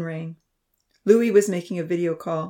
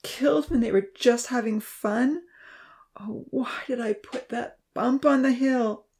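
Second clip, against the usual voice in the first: tearful lament; 6 semitones higher than usual. A narrator's reading. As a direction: mourn it through tears, blaming yourself. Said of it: "as tears rolled down his cheeks".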